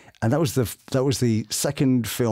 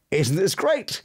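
hopeful showing off; 8 semitones higher than usual. Retold or imitated. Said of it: "you go".

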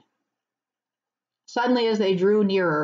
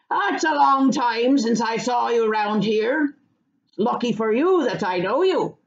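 country farmer, friendly but watchful; 3 semitones higher than usual. Acted. Farmer warning Pippin about queer folk, friendly rustic talk. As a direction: chat like a wary countryman.

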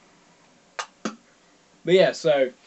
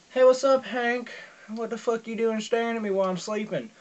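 startled greeting; 7 semitones higher than usual. Retold or imitated. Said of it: "wake up and go".

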